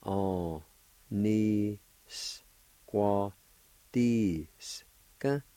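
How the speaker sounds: very slow On-ni-squatisca, each syllable apart; syllable-by-syllable pronunciation. Shown as a demonstration. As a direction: stretch out On-ni-squatisca, pausing between syllables. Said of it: "it's pronounced like this".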